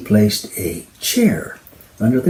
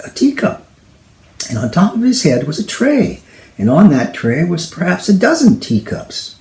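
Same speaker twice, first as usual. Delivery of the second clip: usual speaking voice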